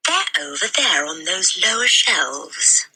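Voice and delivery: slow, gentle grown-up voice; kindly librarian. Acted. Missus Phelps shows Matilda the shelves, slow and kind. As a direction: point the way kindly and slowly. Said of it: "Missus Phelps told her".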